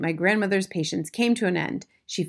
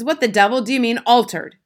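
loud, higher, incredulous demand; angry shout; 5 semitones higher, 9 dB louder than usual. A narrator's reading. Mister Jenkins demands an explanation, shouting angrily. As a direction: shout it in outraged disbelief. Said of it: "shouted Mister Jenkins".

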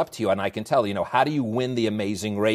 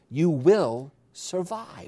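drawn out after a pause, pitched higher; slow, emphatic quote; 5 semitones higher, much slower than usual. Retold or imitated. Host driving home the guest's line, slow and emphatic. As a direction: land it very slowly as the key point.

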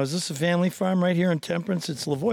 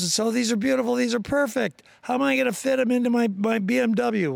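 mock gushing lady, pitched higher; a lightly higher, breathless gush over the stalks; imitating the rich lady; 7 semitones higher than usual. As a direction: play up the impressed city woman. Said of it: "She goes".

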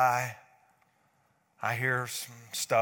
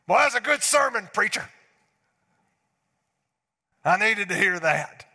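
loud, higher, gushing churchgoer praise; mock praise; 6 semitones higher, 7 dB louder than usual. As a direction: ham up the satisfied churchgoer.

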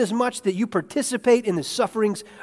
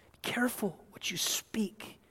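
whispered warning; 4 semitones higher, 10 dB quieter than usual. Real, not acted. Pastor whispers a warning to the congregation. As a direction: drop to a whisper to warn.

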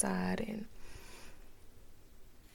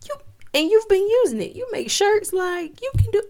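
hyped self-talk; 7 dB louder than usual. Retold or imitated. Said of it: "Oh, you have a Cricut machine".

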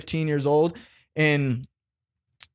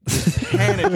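much higher, cracking with laughter; laughing. Real, not acted. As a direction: squeak it out mid-laugh. Laughing while recalling panic.

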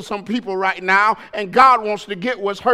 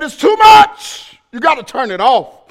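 exasperated yell; 7 semitones higher, 7 dB louder than usual. Retold or imitated. Complaining to son about noise.

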